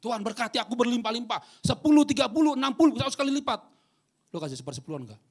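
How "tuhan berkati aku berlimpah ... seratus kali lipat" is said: high, loud greedy prayer; mock a greedy believer demanding blessings; selfish churchgoer praying. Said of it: "Gereja yang egois". (meniru jemaat egois berdoa dengan keras)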